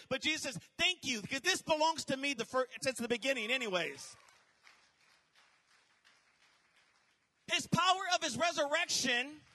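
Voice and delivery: rising, loud sermon build; building from firm to shouting; fiery preaching. Acted. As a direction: preach it to the crowd with fire. Preaches at full force.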